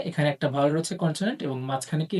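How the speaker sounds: ordinary talk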